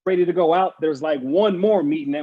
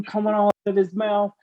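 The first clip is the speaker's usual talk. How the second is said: ordinary talk, nothing marked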